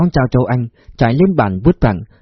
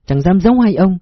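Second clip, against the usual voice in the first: put-on higher, deferential young woman; humble woman voice; 6 semitones higher than usual. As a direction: speak up politely, eager to please. Phúc answers the officers.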